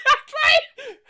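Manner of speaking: shouted triumphant agreement; loud and gleeful. Acted. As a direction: crow it out loud. Teacher gleefully scolding.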